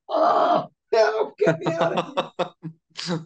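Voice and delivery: laughing mock dismay; mock horror. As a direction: squeal it out as if embarrassed to death. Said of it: "I'm going like".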